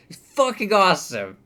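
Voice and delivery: ordinary delivery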